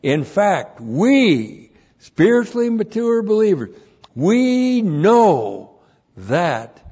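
high, loud, drawn-out final words; preacher declaring what mature believers know; emphatic proclamation. Shown as a demonstration. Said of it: "you translate it that".

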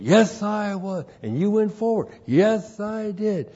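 a hint of an eager new believer. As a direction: answer brightly as the new convert. Man answers pastor in retold visit.

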